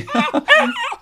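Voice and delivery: a sudden squealing laugh, way up high; high squeal of laughter. Acted. Woman squealing with laughter as the big dog jumps up at her. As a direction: shriek out a giggle as the dog leaps.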